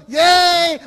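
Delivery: high, loud gleeful yay; excited cheer. Retold or imitated. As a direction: cheer like a thrilled teen. Youths cheer for a gimmick.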